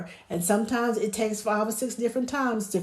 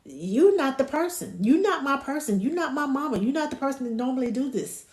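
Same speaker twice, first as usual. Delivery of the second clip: higher-pitched mock protest; insistent, playful complaint in higher pitch; mad baby; 6 semitones higher than usual. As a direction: voice an upset baby objecting. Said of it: "They're mad".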